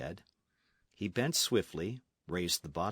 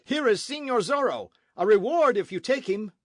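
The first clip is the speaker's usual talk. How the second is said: loud, much higher alarm call; shouting for help; 12 semitones higher, 8 dB louder than usual. Acted. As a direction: rouse the house against Zorro. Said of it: "the captain shouted suddenly".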